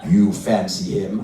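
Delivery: low, slow, questioning husband; ponderous put-on male character; man's dummy voice. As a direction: challenge her in a deep voice. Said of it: "What about a voice like this?".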